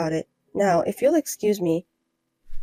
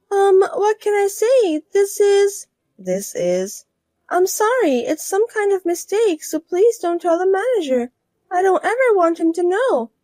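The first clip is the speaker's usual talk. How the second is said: higher, slow nervous plea; higher voiced woman begging to keep it secret; flustered pleading wife; 10 semitones higher, 4 dB louder, slower than usual. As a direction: beg her not to tell, higher.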